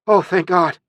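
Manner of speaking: high, loud cry of relief; a burst of thankful relief; relieved outburst. Acted. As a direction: exclaim it high, flooded with relief. Relief on finding missing boy.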